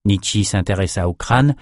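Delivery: ordinary delivery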